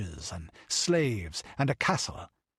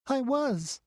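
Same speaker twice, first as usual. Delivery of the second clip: put-on much higher breathless little girl; breathless child. A narrator's reading. The child replying to her lady, breathless little girl's voice. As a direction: answer quickly, out of breath. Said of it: "answered the child breathlessly".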